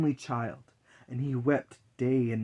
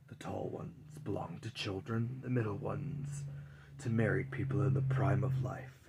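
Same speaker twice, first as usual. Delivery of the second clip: low, hushed whisper of Death; 3 semitones lower, 8 dB quieter than usual. Acted. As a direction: breathe the words like Death in the dark. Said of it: "said Death".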